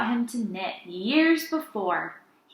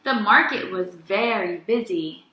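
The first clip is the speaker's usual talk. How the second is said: usual speaking voice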